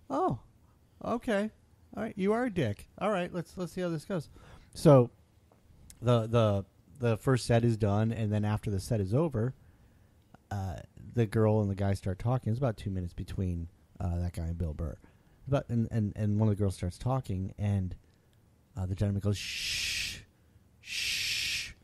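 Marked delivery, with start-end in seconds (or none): 0.1-4.3 much higher, sarcastic sing-song; mock him in a high snide voice; high snide sarcasm. Mocking the guy in thought. 19.4-21.7 whispered shh, shh; whispered shush. Retold or imitated. Gentleman hushing the girl.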